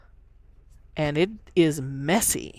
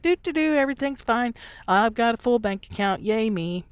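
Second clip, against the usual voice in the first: much higher, sing-song and breezy; mock carefree; 5 semitones higher than usual. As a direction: hum along like nothing's wrong. Mock carefree pretend rich self.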